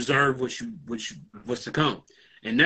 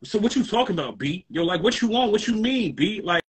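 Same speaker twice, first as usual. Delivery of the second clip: put-on New York accent; 4 semitones higher than usual. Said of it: "No, I'm playing".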